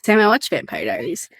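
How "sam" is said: ordinary delivery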